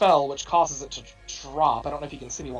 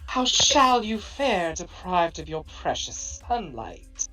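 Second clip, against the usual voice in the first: slow, hollow ghostly drawl; 3 semitones higher, much slower than usual. Acted. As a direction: purr it slowly like a spirit in the dark. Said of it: "a ghostly voice issues from nowhere".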